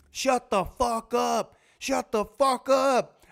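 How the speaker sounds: high, drawn-out strained yell; hammed-up rage, each word stretched; acted furious yell. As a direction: scream at a panicking partner to be silent. Acted screaming quarrel in the plane.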